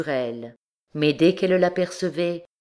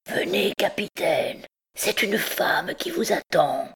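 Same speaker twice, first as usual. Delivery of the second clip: half-whispered, sepulchral urging; sepulchral messenger; 4 semitones higher than usual. A narrator's reading. Hunchback coaxes the captain to follow, sepulchral half-whisper. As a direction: urge him in an eerie, whispery voice. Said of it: "à la voix sépulcrale de l'étrange messager".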